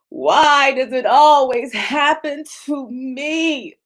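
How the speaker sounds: poor-me victim whine; whiny victim. Acted. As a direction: moan it like the world is against you. Acting out a victim.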